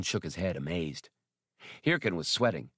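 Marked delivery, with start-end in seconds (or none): none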